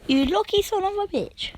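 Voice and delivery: high squeaky voice. Real, not acted. Commentator mock envy.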